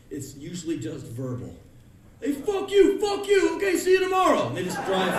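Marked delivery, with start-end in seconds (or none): 2.2-4.5 high, loud street shouting; New York yelling. Retold or imitated. Drivers cursing each other, loud shouting.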